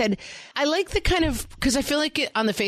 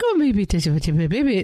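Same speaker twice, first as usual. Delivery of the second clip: put-on cooing to a baby; slower than usual. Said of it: "That's your voice all day".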